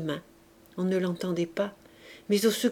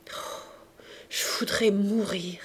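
whispery murmur from the depths; very low murmur; 4 dB quieter than usual. A narrator's reading. Jean Valjean sobbing in the armchair, despairing murmur. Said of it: "l'entendit murmurer si bas".

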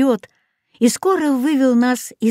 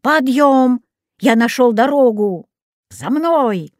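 raised, brisk-spirited summons; rallying call; 4 semitones higher, 3 dB louder, slower than usual. A narrator's reading. Dog returns having found the road, rallying. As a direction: rouse them with a higher voice.

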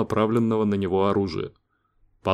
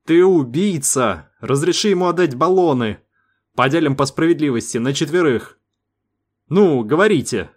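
higher, louder frightened pleading; begging in rising panic; panicked outburst; 5 semitones higher, 3 dB louder than usual. A narrator's reading. Сейга pleads with the crew for a share of air.

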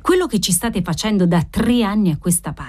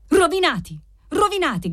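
high, falling wail of despair; anguished cry; 9 semitones higher than usual. A narrator's reading. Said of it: "Gridò un coro di voci straziate".

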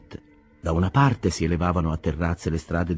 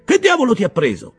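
very high, loud scolding; angry captain; 13 semitones higher, 6 dB louder than usual. Acted. Captain scolds Queequeg, high and loud. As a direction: tear into him for nearly killing the boy.

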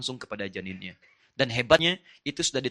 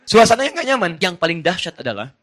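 fiery preaching; 6 semitones higher, 12 dB louder than usual. Acted. Preaching at full force.

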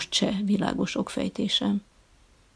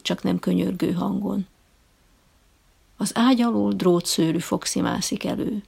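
ordinary delivery

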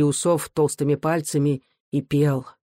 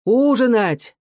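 raised, higher-pitched demand; acted yell at his mother; drunken shout; 6 semitones higher, 4 dB louder, slower than usual. A narrator's reading. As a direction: call out roughly for supper. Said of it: "крикнул матери".

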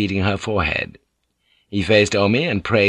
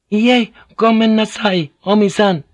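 polite; 12 semitones higher, 6 dB louder than usual. A narrator's reading. Fujiko refuses Omi while holding the pistol, high and polite. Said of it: "her voice was soft and polite".

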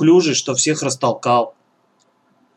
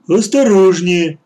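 loud, slow, higher warning; cautioning lummox; 5 semitones higher, 6 dB louder, slower than usual. A narrator's reading. Clumsy son warns children climbing down, cautioning. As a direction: draw the warning out loudly.

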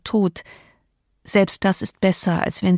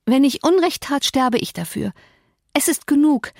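high, tearful woman's voice; schluchzend; 7 semitones higher than usual. A narrator's reading. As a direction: plead as if close to sobbing. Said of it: "schluchzte Catherine". (mit hoher, schluchzender Stimme)

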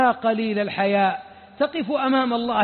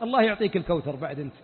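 usual speaking voice

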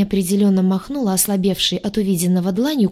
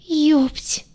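high, small squeaked curse; 8 semitones higher than usual. A narrator's reading. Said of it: "пискнула я".

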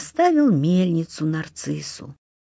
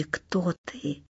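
bewildered babble; 2 semitones lower, 10 dB quieter than usual. A narrator's reading. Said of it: "лепечет в недоумении".

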